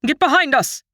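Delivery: high urgent shouted order; yelling. A narrator's reading. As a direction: bark the command over the fight. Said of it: "the Sylph captain yells".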